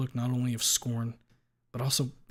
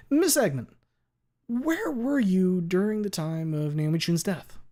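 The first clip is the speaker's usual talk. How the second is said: higher, louder lawyer question; 8 semitones higher, 6 dB louder, slower than usual. Acted. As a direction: ask it like a sharp attorney.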